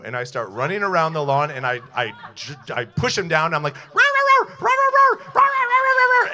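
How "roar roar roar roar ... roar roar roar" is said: high, very loud roaring like a dinosaur. Retold or imitated. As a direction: roar wildly like a costumed dinosaur at a party. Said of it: "So I act like Dino".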